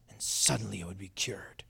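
sudden dramatic whisper; low, breath-only reveal after the big build-up; hushed wonder. Acted. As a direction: drop to an awed whisper. Hushed payoff of the imagined ritual.

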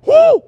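loud high whoop; sudden high shout of alarm; hoot. Retold or imitated. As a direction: let out a startled whoop. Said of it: "he's like".

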